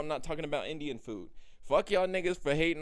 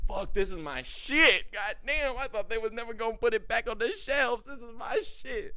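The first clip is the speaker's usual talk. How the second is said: thrilled, higher, louder; delighted shopper; 6 semitones higher, 3 dB louder than usual. Retold or imitated. Imitating a shopper thrilled to find his favourite again. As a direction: gush over a favourite product. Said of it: "motherfucker is like".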